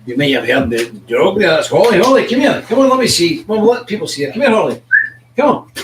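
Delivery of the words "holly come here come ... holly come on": high, loud call to a dog. Real, not acted. Host to his dog Holly, come let people see her, calling out.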